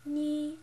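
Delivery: "ni" held high and level; flat first tone. Shown as a demonstration. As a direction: keep "ni" on one high, unmoving pitch. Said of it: "the flat tone".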